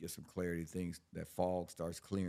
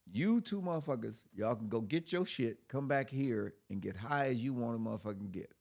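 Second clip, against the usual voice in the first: mock cop announcement; 5 semitones higher, 5 dB louder than usual. Retelling the cop's offer.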